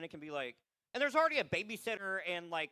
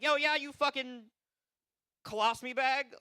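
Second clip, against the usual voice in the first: mock insult; 7 semitones higher, 4 dB louder than usual. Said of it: "so I was like".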